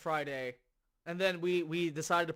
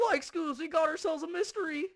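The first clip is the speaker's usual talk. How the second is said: lightly voiced goofy Shaggy; a hint of a scared cartoon stoner; Shaggy impression; 9 semitones higher than usual. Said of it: "My Shaggy impersonation".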